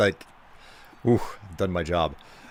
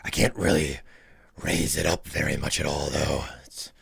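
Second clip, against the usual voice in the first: low, rough smoker-like voice, mock serious; limited low vocal range; 6 semitones lower than usual. Shown as a demonstration. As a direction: play it as if stuck deep at the bottom of your range. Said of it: "this is my vocal range".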